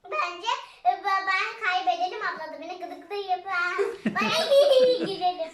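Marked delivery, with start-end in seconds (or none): 4.2-5.0 sudden loud giggle; loud burst of laughter. Real, not acted. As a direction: break into giggles. Laughing burst mid-sentence. (kahkaha patlaması)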